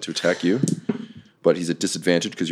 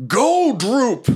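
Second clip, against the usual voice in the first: much higher shouted command; bossy bugbear; 3 dB louder than usual. Acted. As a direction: bark an order at the goblin. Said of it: "he takes the goblin and he says".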